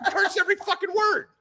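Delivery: high, laughing disbelief. Real, not acted. Teasing Bill about his cursing, laughing high.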